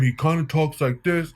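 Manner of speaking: a hint of a celebrity's speaking voice; Shia LaBeouf impersonation. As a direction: do a rough, half-committed imitation of the actor. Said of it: "that's my worst Shia LaBeouf impersonation".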